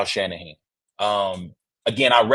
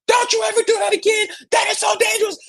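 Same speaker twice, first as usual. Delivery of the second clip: very high, hammed-up screaming scold; frantic scolding; faster than usual. Wife scolding the son who ran toward the street, high hysterical mock scream. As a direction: shriek the telling-off like a panicked mother. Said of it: "she is losing her mind".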